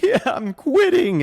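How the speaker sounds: high, loud, slow, half-sobbed; tearful quitting. Retold or imitated. Quitting to his bosses on Zoom, high and tearful. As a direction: blurt it through tears, drawn out. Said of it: "And I was like".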